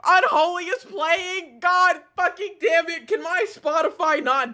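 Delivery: high, loud cursing; exasperated outburst. Real, not acted. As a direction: curse your luck loudly. Song comes on at a bad moment.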